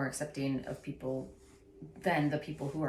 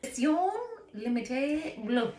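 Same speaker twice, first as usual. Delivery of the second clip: French phrase; 7 semitones higher than usual. Shown as a demonstration. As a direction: draw out the French words. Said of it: "French, you know".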